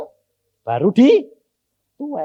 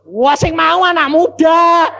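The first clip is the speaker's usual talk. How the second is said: calling out; 12 semitones higher, 8 dB louder, slower than usual. Real, not acted. Preacher calling out to the congregation, loud and high. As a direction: raise the voice to rouse the crowd.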